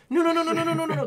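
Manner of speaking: high, loud rapid-fire panicked wail; mock panic. Imitating a man curled up at a VR demo, panicked high wail. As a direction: wail it in a helpless string. Imitating VR player in fetal position.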